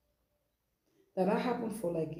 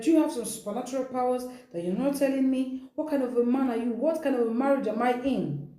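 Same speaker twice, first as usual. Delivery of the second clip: quick, raised, pressing questions; agitated questioning; 6 semitones higher, 4 dB louder than usual. Retold or imitated. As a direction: fire the questions at him, half-acted. Wife confronts husband, retold.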